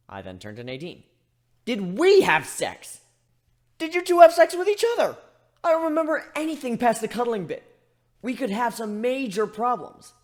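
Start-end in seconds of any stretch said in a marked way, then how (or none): 1.6-10.1 much louder, much higher panicked questions; near-shrieking in alarm; frantic panic. A narrator's reading.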